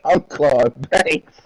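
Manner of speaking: slow, drawn-out movie quote, lightly voiced; Claude Banks impression. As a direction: stretch every word out slowly like the film character.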